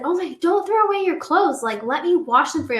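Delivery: high, sweet and eager to help; mock the kind friend offering to do the laundry; sweet helpful friend. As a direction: friend gushing about saving the clothes, high and sweet. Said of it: "And she said".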